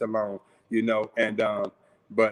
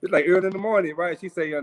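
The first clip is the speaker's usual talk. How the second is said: normal talking voice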